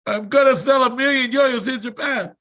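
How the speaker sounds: high, forceful declaration; building frustration. Retold or imitated. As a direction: push the statement out with growing force. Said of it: "started coming into my voice".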